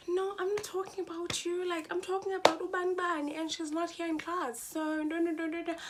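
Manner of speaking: high-pitched mocking imitation; annoying girl. Classmate insisting she meant someone absent, high mocking babble. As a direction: mimic her babbling excuse up high. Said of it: "she was so annoying".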